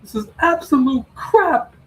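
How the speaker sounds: high, loud hammed-up shout; cussing fan losing it over the show; mock angry rant. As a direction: play a furious fan yelling at the show. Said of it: "J D from New York, ladies and gentlemen".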